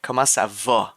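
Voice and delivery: 'va' rounded to a back 'vo'; Quebec pronunciation. Shown as a demonstration. Streamer repeats Quebec version, 'va' said as 'vo'. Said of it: "in Quebec".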